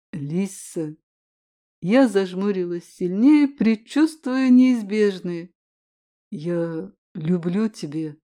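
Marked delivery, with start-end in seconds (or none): 0.1-1.0 soft low call. A narrator's reading. Mage calls her softly. 6.3-8.2 soft, slow and low love confession; tender confession. A narrator's reading.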